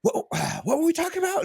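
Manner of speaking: high, hurried, sighing confusion; mock dazed husband. Husband forgetting what he asked, as mock daze. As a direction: lose the thread with a sigh, then rush the question. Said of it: "And then he's like".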